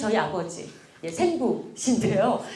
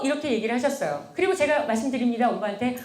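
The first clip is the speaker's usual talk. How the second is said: normal talking voice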